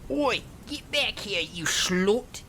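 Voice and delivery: acted angry shout in a put-on high voice; mock shouting. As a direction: yell after someone fleeing, volume held back. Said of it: "he kept shouting".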